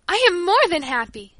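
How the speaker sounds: high, loud, elated exclamation; ringing delight, pitch soaring; overjoyed. A narrator's reading. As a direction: declare it bursting with joy. Paula overjoyed by gift.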